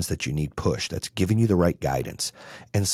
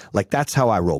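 ordinary talk

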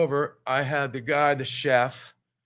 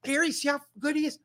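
high mock gush about Gary; imitating wife. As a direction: swoon over Gary in a raised voice. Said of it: "Yeah, she".

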